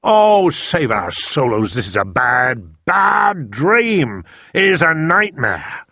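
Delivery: a giant both outraged and anguished; fury and anguish. A narrator's reading. BFG to Sophie about the nightmare, furious and anguished. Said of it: "filled with fury and anguish".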